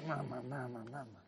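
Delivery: low, very soft repeated grumble; mock muttering. People frustrate you and nobody hears, mock muttering. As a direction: mumble it under your breath in imitation. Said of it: "you mutter to yourself".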